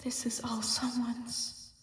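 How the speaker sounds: mysterious voice whispering a clue; eerie whisper. A narrator's reading. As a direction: echo it like a memory. Echoing mysterious voice.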